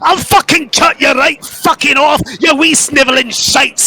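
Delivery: high, far-off furious Scottish shouting; raging Scottish mycon. Acted. Fergus screaming curses at the goblins, high furious Scottish rant. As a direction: spit every curse at the goblins.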